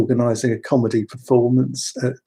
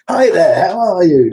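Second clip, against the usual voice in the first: higher, louder, beaming TV host hello; hearty showbiz greeting; 7 semitones higher, 8 dB louder than usual. Retold or imitated. Recalling meeting Leslie Crowther, booming friendly hello. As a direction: greet like a cheery game show host. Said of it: "Leslie Crowther".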